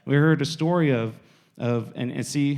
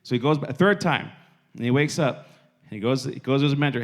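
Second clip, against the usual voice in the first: normal talking voice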